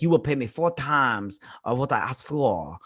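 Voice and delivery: badly faked French accent; mock French accent. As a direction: attempt a French gunman's accent and miss. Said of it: "I'm not good with the French accent".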